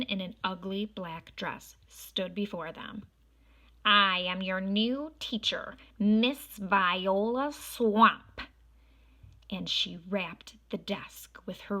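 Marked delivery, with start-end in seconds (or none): 3.8-8.4 loud, very slow, menacing witch teacher; witchy teacher. Acted. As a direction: announce yourself like a nasty schoolmarm. Mean new teacher introduces herself.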